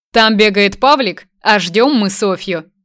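loud and proud, slowing; proud announcement. A narrator's reading. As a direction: beam with pride. Proud father announces children.

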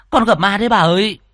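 high, loud cry of alarm; frightened cry. Acted. Maid tells her mistress of a ghost, frightened cry.

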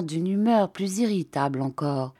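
usual speaking voice

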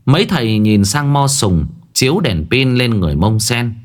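plain reading voice

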